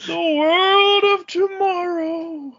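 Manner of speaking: very high, slow, crying-out showman voice; mock announcer. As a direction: sell the future like an old newsreel host. Joking futuristic announcer line.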